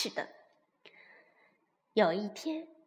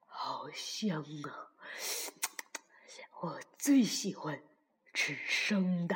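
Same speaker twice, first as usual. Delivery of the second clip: drowsy, soft, low whispered murmur; sleeping fox murmuring about a tasty meal; talking in sleep; 5 semitones lower, 4 dB quieter, slower than usual. A narrator's reading. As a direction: mumble dreamily as if asleep. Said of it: "还说起梦话来".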